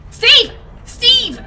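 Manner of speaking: very loud, high, urgent yell; screaming a name. Real, not acted. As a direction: yell for your teammate in alarm. Calling teammate in danger.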